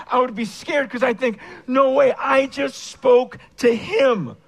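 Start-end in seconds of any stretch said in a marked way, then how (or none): 1.7-4.4 loud, slow, amazed outburst; retelling a stunned moment after prayer, loud and slow. Retold or imitated. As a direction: blurt it out in disbelief. Retold awe at answered prayer.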